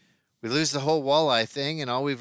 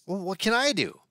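1 alarmed question; 9 semitones higher than usual. A narrator's reading. Alarmed by Pasquale's news.

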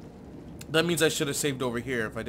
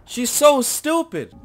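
slow, very loud high yell; furious shout; 12 semitones higher, 17 dB louder, much slower than usual. Real, not acted. Player raging at the character, furious shout. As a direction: scream in frustration. Frustrated with the game.

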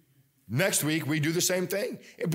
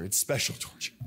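whispered aside; 6 semitones lower, 4 dB quieter than usual. Real, not acted. Aside about the Bears.

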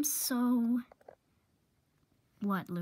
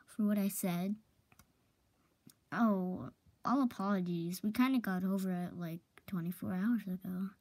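usual speaking voice

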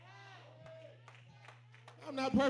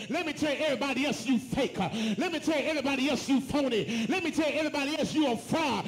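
ordinary talk

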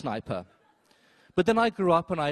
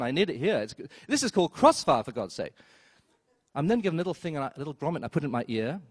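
normal talking voice

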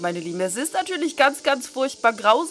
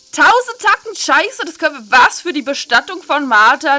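loud, higher, furious outburst; outraged; 3 semitones higher, 9 dB louder than usual. Real, not acted. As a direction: explode at the price. Outraged at the funeral bill.

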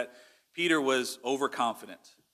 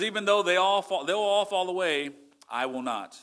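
higher-pitched confident boast, settling at the end; confident quote; 5 semitones higher than usual. Retold or imitated. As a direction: quote a confident pledge, pitch dropping on the refusal. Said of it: "he was very confident about that".

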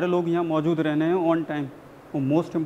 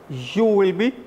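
slow emphatic; 2 semitones lower, much slower than usual. Acted. Teacher rousing the class.

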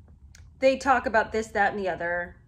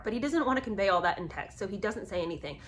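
ordinary talk, nothing marked

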